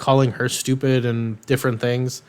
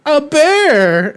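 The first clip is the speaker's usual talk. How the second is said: high, loud mock wail; hammed-up panicked girl; mock frantic girl; 14 semitones higher, 8 dB louder than usual. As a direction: blurt it high and loud like a scared kid. Frantic girl answers the crowd.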